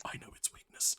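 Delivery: a secret agent's whisper; hushed conspiratorial whisper; spy whisper. Retold or imitated. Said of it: "just be like".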